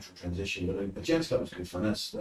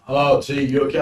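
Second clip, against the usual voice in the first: louder friendly call; 4 semitones higher, 10 dB louder than usual. Retold or imitated. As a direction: hail him from across the road. Lady calling across road.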